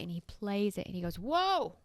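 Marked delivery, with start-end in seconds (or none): 1.2-1.8 high, startled exclamation; surprised whoa. Retold or imitated. Friend hearing the odd bowl sound, startled. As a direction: caught off guard by a sound.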